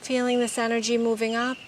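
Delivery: normal talking voice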